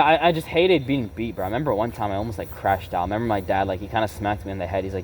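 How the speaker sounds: ordinary talk, nothing marked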